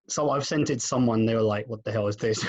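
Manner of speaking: normal talking voice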